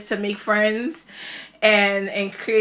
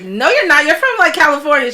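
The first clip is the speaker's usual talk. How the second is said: teasing denial; 5 semitones higher, 7 dB louder than usual. Retold or imitated. Insisting she's American.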